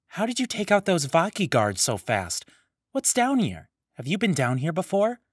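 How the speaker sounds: put-on chattering young Matoran. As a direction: voice a small nosy Matoran firing off questions.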